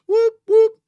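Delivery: high, loud siren whoop; mock siren; siren noise. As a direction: imitate a police siren. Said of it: "This is the police".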